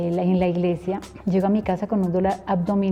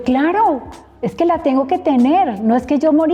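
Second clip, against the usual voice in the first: excited explanation; 7 semitones higher, 6 dB louder than usual. Retold or imitated.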